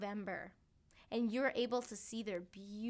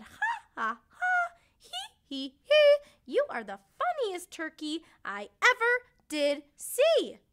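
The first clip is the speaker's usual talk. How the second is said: very high, loud hee hee teasing; mocking turkey laughter; 10 semitones higher, 11 dB louder than usual. A narrator's reading. Turkey friends laughing at purple Timmy, high and loud. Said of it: "They said".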